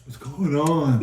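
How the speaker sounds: slow, drawn-out waking question; lightly acted sleepy confusion; just woken up. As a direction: imitate someone stirring from sleep. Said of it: "then Tracy wakes up".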